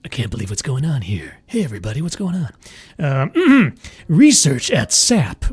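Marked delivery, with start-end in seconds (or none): 0.0-3.3 low, quiet mock Australian accent like a movie tough guy; mock Australian accent, lower and softer; Jason Statham Australian accent. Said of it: "a Jason Statham or something".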